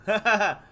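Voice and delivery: loud, high burst of laughter; gloating laugh. Real, not acted. Gloating over making his worst fear come true, loud high laugh. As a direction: laugh out in triumph.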